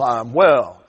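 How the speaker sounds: slow, low Alabama drawl; lightly voiced Southern drawl. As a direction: stretch it out like an old Southern gentleman. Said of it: "had this real southern drawl".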